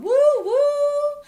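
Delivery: very high, loud hooting train whistle; train whistle imitation. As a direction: mimic a distant train whistle. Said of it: "The train whistle".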